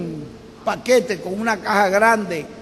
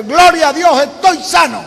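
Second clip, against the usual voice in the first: joyful cry; 5 semitones higher, 7 dB louder than usual. Acted. Healed man rejoices aloud.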